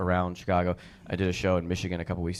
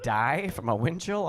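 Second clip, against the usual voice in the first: incredulous; 6 semitones higher, slower than usual. Acted. Incredulous reply to mom's warning.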